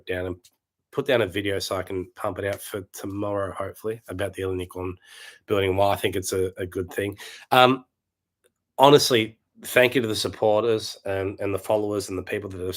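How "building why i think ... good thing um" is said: ordinary talk, nothing marked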